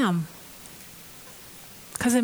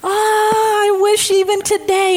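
high, louder anguished wail; pained outcry, higher and louder; crying out; 9 semitones higher, 4 dB louder than usual. Acted. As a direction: cry it out in heartbreak. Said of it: "He's crying".

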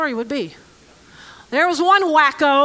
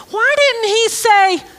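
usual speaking voice

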